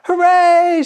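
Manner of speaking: high, loud joyful cheer; big happy hooray; crowd cheering. A narrator's reading. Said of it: "shouted the people".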